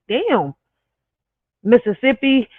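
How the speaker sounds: normal talking voice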